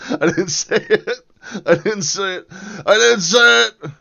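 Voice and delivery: comic panicked backpedalling; mock protest. Joking denial after mock Canadian phrase.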